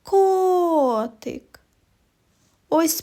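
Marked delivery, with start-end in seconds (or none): 0.0-1.6 higher Котик with a long stressed first vowel; Котик called with its first syllable stretched; stressed, lengthened О. Shown as a demonstration. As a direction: call out Котик, holding the О. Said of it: "промовляють його з більшою силою і довготою".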